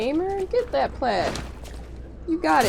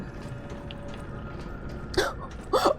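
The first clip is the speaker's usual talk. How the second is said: gasp; 6 dB quieter than usual. Real, not acted. Reacts to the movie line.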